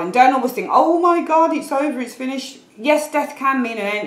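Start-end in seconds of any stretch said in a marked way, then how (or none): 0.7-2.6 high, loud mock panic; mock worry. Reader imitating viewers who draw the death card, mock panic. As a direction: overreact as if all is lost. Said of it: "don't always worry about that card".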